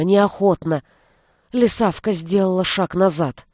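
ordinary delivery

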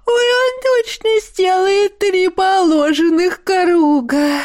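high, slow little creature voice; cheeky otter voice. Acted. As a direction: play a spoiled pet begging. Otter Ларинь speaks.

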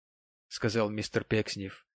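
ordinary delivery

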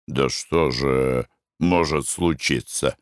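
low, slow, dismissive husband; lazy husband. Acted. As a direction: shrug it off sleepily.

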